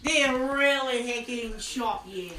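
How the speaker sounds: put-on Aussie voice; Australian accent. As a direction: play along in mock Australian. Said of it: "do an Australian voice".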